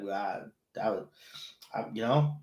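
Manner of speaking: ordinary talk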